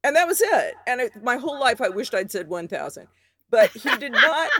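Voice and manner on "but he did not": laughing. Real, not acted. Laughing at the easy win.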